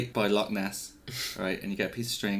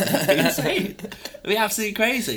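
excited; 11 semitones higher, 5 dB louder than usual. Real, not acted. Imagining giant chicken, laughing.

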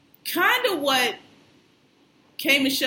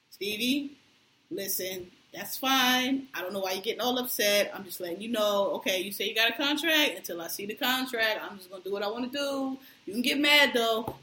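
much softer, low and even, almost murmured; calm reply; 3 semitones lower, 6 dB quieter than usual. Retold or imitated. Speaker acting Jocelyn answering Stevie, soft calm murmur. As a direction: swallow the anger and answer sweetly calm. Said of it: "But what comes out is".

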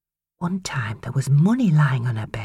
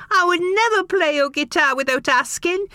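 put-on high, tearful boy's voice; sobbing. A narrator's reading. Boy sobbing to his father, high and tearful. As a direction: plead through sobs, higher. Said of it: "Casey sobbed".

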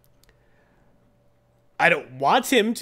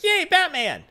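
put-on squeaky high child cheering; excited little kid; 6 dB louder, slower than usual. A little kid cheering for Batman, squeaky and high. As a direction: cheer like a thrilled five-year-old. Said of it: "like, a five-year-old".

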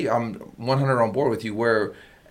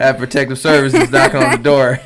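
laughing; 9 dB louder than usual. Real, not acted. Joking about a crude remark.

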